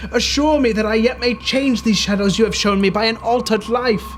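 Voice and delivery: anguished pleading. Acted. Scrooge at his own grave begging the spirit, anguished. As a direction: cry out to the spirit for mercy.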